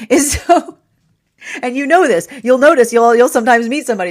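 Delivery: high, loud burst through laughter; amused, laughing. Real, not acted. Reader sharing a knowing joke with listeners, amused and laughing. Laughs while making her point.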